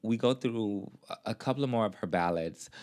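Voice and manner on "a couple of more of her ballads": ordinary talk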